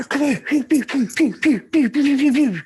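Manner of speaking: loud, high mock blaster noises; playful sound-effect chant; pew pew sound effects. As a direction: fire off pew pews like a toy gun. Miming fast lorry unloading noises.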